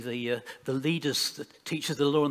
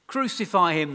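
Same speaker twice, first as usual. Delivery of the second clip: raised, louder reading of a crowd's cry; understated shout; 4 semitones higher, 8 dB louder than usual. A narrator's reading. Crowd demands a sentence from Pilate, restrained shout. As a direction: lift the voice for the shout without yelling. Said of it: "they shouted".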